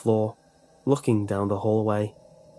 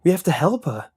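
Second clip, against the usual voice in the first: cried out; 8 semitones higher, 4 dB louder than usual. Acted. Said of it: "Nick cried".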